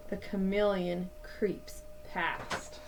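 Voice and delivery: plain reading voice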